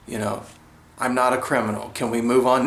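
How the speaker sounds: ordinary talk, nothing marked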